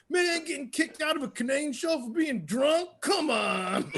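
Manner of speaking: high, laughing mock outrage; high laughing mock complaint about being kicked out; mock indignant. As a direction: play it up high, amused and indignant. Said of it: "he just goes".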